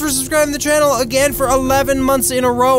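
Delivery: high, gushing gratitude; giddy streamer hype; hyped subscriber shout-out. Real, not acted. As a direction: thank them in an excited high pitch. Hypes a long-time subscriber.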